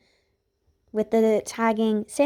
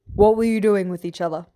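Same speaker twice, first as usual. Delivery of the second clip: stern guard; 4 dB louder, faster than usual. A narrator's reading. Guard questions girls sternly.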